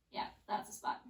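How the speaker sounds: breathy whisper under strain. Real, not acted. Instructor pressing on a tight thigh muscle, whispering through the strain. Feeling a tight spot while rolling.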